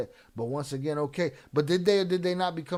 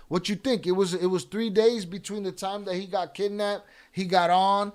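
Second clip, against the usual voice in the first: loud, raised-pitch heated rant; angry rebuke; 3 semitones higher, 7 dB louder than usual. Real, not acted. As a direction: tell off a chatter, loud and indignant. Host angrily scolds a chatter.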